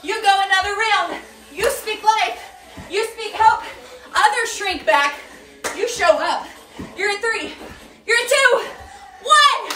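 loud, high motivational preaching over the set; rally them not to shrink back; fired-up coach sermon. Acted. Coach preaching courage to the skaters in the last reps.

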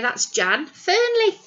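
ordinary delivery

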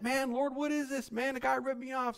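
mock outrage, pitched high; upset pastor. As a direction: act the cheated pastor, fed up. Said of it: "He gets upset".

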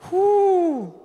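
sudden high excited whoop. Real, not acted. Preacher whoops after telling the Facebook story. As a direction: let out a joyful whoop.

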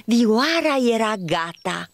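ordinary delivery

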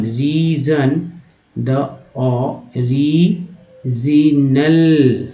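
"Reason" and "original" drawn out very slowly; each syllable held long, "री-ज-नल" with a stretched final L; broken-down pronunciation. Shown as a demonstration. Teacher sounding out words for learners, very slow syllable-by-syllable delivery. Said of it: "ऐसे तोड़ के पढ़ना है".

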